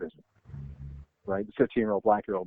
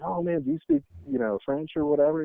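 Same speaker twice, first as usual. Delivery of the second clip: own younger self, high; 6 semitones higher than usual. Retold or imitated. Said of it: "I'm like".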